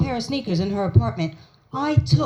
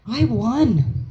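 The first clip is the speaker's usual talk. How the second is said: normal talking voice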